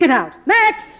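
loud, high shout for the butler; calling out. Acted. Star shouting for her butler to remove intruder. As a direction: summon Max at full voice.

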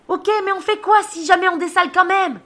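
high-pitched hollered question; screamed question. Acted. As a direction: yell back, insisting on an answer. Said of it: "Alors je hurle".

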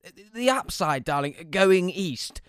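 dad spelling it out again, emphatic; insistent dad. Retold or imitated.

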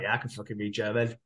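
low, soft acted nonchalance; imitating a casual, muted comeback; offhand reply. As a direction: answer as if it's obvious. Acted offhand answer to surprised question.

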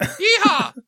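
high Texan cowboy whoop; Texan yeehaw. Pretend. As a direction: holler it like a Texas rancher. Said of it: "I gave him a Texan accent".

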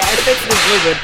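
ordinary talk, nothing marked

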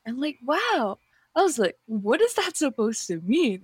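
ordinary talk, nothing marked

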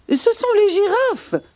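very high-pitched gazelle voice. Acted. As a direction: pipe up high, passing the blame. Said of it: "affirment les gazelles".

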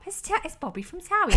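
high, starstruck gasp of recognition; excited. Retold or imitated. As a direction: blurt out the name, thrilled. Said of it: "I was like".

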